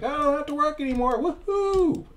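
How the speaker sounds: very high, loud mock celebration; pumped-up. Game worker freed from his job, hammed-up high excitement. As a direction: squeal it fast like an overjoyed little worker. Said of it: "He's pretty pumped".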